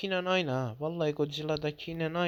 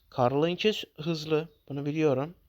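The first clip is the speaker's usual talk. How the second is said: normal talking voice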